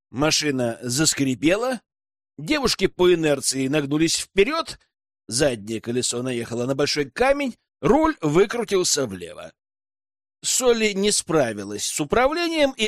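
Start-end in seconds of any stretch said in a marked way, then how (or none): none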